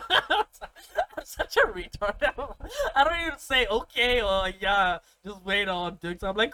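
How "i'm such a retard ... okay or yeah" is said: high, loud, helpless laughter in the words; laughing at himself. Real, not acted. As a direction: talk through laughter at your own foolishness. Laughing at own retold reaction.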